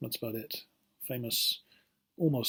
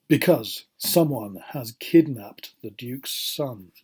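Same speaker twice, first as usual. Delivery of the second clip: story reading for learners; 2 semitones higher, 4 dB louder than usual. Acted. Teacher reading a detective story to students, animated storytelling. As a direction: read it dramatically, lifting the suspenseful questions.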